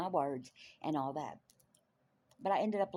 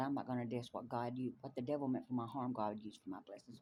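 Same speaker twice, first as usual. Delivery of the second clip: ordinary talk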